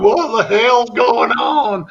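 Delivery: high, slow, drawn-out hillbilly holler. Retold or imitated. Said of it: "her hillbilly redneck boyfriend".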